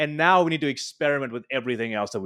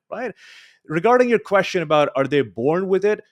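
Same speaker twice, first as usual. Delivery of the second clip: ordinary talk, nothing marked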